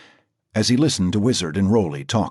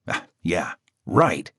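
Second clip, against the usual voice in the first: sarcastic; 3 semitones lower, slower than usual. A narrator's reading. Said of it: "replied Louis, sarcastically".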